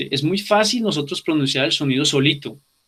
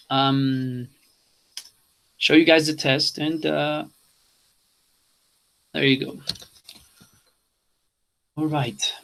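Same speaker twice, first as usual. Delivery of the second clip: normal talking voice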